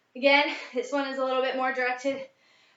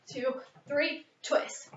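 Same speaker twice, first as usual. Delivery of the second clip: ordinary delivery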